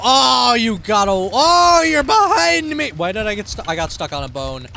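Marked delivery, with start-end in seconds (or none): 0.0-2.9 very high, loud outburst; shouted surprise. Real, not acted. Player finds someone behind, startled shouting. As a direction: shout in sudden realisation.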